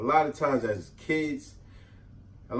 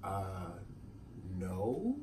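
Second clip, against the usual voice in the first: much softer, lower timid answer; timid kid; 9 semitones lower, 17 dB quieter than usual. Retold or imitated. Kid answers the aunt, soft and timid. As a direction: mumble it small. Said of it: "I'm like".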